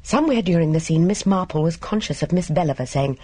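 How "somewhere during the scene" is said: plain reading voice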